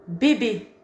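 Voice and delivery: crisp bébé with closed é sounds; clear model bébé. Shown as a demonstration. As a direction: model the word bébé cleanly, both é tight and closed. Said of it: "mais c'est un mot utile pour apprendre".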